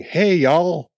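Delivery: a Brit doing a bad Texan; mock American drawl. Brits faking an American accent, hammed-up cowboy. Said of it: "Do an American accent".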